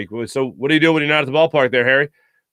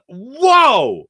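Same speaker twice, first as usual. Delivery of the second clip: mock amazed whoa; 9 semitones higher, 5 dB louder than usual. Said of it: "I was".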